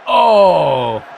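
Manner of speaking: loud groaning cry at a near miss; frustrated shout; shout of disappointment. Real, not acted.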